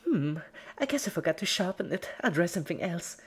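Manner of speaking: high, quick, casual cartoon voice; matter-of-fact Pinky. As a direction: shrug it off brightly. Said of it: "stated Pinky matter-of-factly".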